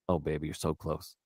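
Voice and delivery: low, soft, breathy-close come-on; mock seductive murmur. Husband teasing his wife on the phone, mock seductive murmur.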